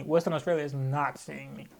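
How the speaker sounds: low, slow, measured repeat; deadpan refusal. Real, not acted. Ruling out Perth for next event, slow firm refusal. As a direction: lay it down slowly and low.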